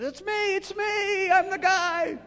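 very high, loud eager shout; high, loud excited confession from the pew; mock shout. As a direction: shout it out like jumping up in church. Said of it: "almost stood up and said".

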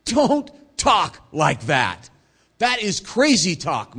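slow, loud, emphatic protest; crying out in protest. Acted. Peter objecting to talk of crucifixion, crying out. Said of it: "cries out".

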